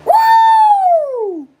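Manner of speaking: very high, loud whoop; piercing playful whoop; falsetto woo. Pretend. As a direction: hoot a falsetto woo that slides down.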